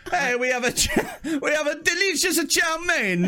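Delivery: high, hammed-up Italian waiter; mock Italian accent. Italian waiter selling chow mein, mock Italian accent. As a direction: push the dish in a comic Italian accent. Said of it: "Chinese restaurant that only hires Italian".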